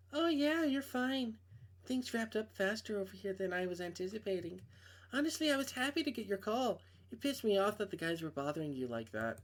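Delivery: a hint of a young woman on the phone; girl voice. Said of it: "I can't do girl voices".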